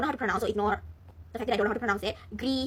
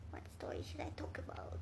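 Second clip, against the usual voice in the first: whispered to herself, unvoiced; 18 dB quieter than usual. Real, not acted. Musing to her listeners in a whisper. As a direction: breathe the question as a whisper.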